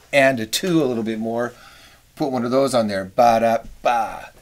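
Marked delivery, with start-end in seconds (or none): 3.0-4.3 sung figure with an accent; accented scat. Shown as a demonstration. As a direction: punch the Ba. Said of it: "if we wanna kick this accent".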